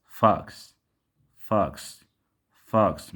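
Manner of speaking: فوكس said "faks", with a short open "ah"; open vowel. Shown as a demonstration. Teacher models the word for students, open vowel in فوكس. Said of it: "فإنه ينطق كالواو المفتوح".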